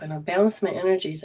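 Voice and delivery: ordinary talk, nothing marked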